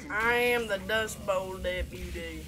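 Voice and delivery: put-on slow, drawn-out Southern deputy accent. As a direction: draw it out in a mock Southern accent. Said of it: "stop with that accent".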